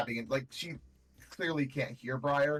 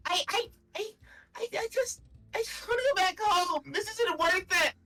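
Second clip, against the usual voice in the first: very high, sobbing, broken apology; crying apology; 3 dB louder than usual. Acted. Phelan sobbing her apology to Briar, high and tearful. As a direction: sob out the apology in a high, cracking voice. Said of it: "wow, I can't do the voice".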